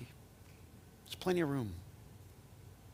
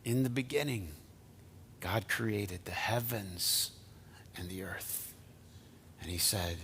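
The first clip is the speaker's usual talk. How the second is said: slow, soft, reverent recitation; quiet scripture reading; 4 semitones lower, 10 dB quieter, slower than usual. Real, not acted. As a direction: lower the voice to read Genesis.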